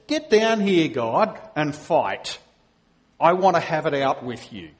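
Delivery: loud, challenging cry to come and fight; defiant shout. Acted. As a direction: yell it up at God, spoiling for a fight. Job challenging God to fight.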